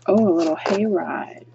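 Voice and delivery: usual speaking voice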